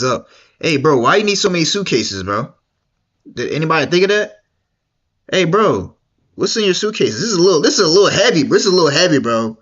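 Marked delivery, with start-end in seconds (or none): none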